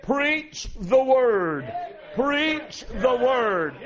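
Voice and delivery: very slow, each word hammered; solemn pulpit command; slow emphatic charge. Real, not acted. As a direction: drag out Preach the Word like a command. Quoting Paul's charge, emphatic.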